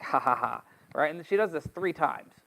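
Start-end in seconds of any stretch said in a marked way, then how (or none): 0.0-0.6 spelled-out mock "ha, ha, ha"; deliberate fake laugh, evenly spaced. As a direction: act out a triumphant laugh, lightly. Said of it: "he's like".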